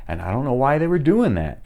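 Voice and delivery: ordinary talk, nothing marked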